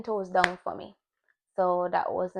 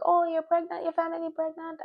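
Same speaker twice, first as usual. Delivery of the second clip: high, eager mock-grandma question; imitating grandma; 9 semitones higher than usual. Quoting grandma asking about pregnancy, imitating her. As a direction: echo grandma's hopeful nagging, pitched up.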